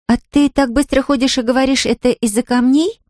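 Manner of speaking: put-on high-pitched child asking; curious girl's voice. A narrator's reading. Girl asks Anfisa about her speed, high curious voice.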